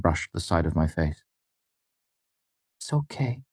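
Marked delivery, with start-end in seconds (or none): 2.8-3.4 soft and high, hushed comfort; gentle, hushed, higher girl's voice; quiet reassurance. A narrator's reading. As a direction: soothe him quietly. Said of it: "she said quietly".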